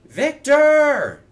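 very high, full-voiced yell; loud shout. A narrator's reading. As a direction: bellow the name high, interrupting. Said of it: "came a loud voice".

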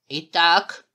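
loud, commanding opener. A narrator's reading. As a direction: open the questioning with authority. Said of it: "командным голосом сказал".

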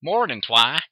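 put-on loud country-girl morning call. A narrator's reading. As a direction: call out a big loud hello. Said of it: "entered without knocking with a loud".

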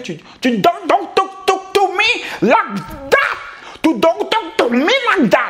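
high, slow, drawn-out English warning; retelling own stern warning. Retold or imitated. To the shouting girlfriend, a slow hammed-up warning. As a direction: stretch it out like a tough guy laying down the law. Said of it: "je t'ai dit avec un peu plus de panache et de charisme".

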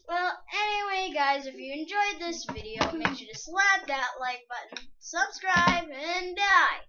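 loud, upbeat sign-off; youtuber outro. Acted. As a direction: hype up the end of the video. Video outro.

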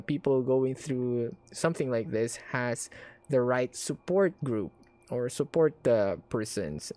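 ordinary delivery

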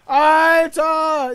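high, loud panicked yelling; panicked shouting. Real, not acted. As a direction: yell the warning in panic. Smoker attack in game.

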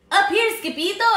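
high, loud holler. Acted. Dogs calling down to Skipito from the volcano, hollering. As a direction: holler down from above. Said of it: "hollered the Chihuahuas".

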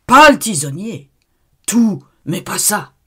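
supplication criée, aiguë; cri suppliant. Acted. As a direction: implore en criant, paniqué. L'abbé supplie en criant. (cri de panique)

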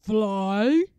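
loud troll roar; booming troll question. Acted. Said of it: "the trolls roared".

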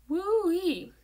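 high, loud exclamation of wonder; amazed whoop. A narrator's reading. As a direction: whoop it out, swooping high then falling. Gloria amazed at the page count.

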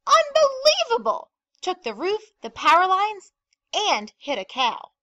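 very high, loud outburst that settles by the end; amazed gossip. A narrator's reading. Mayor Hatch phoning Pearly Beach with the news, loudly amazed. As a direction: burst out in disbelief, then list the damage. Mayor relays growing rumour.

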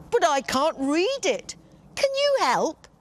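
high, loud pleading voice; pleading giant. Acted. Giant pleads with the fairy, high and loud. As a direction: beg for help.

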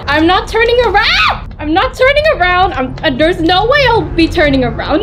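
high, hurried, nervous babble; fast, frightened insistence; panicked refusal. Real, not acted. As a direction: refuse in a high rush of nerves. Afraid of what is behind.

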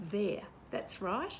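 ordinary delivery

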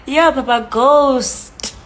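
usual speaking voice

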